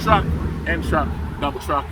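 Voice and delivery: ordinary talk, nothing marked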